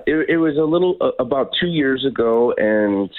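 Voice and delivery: normal talking voice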